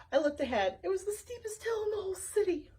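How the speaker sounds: usual speaking voice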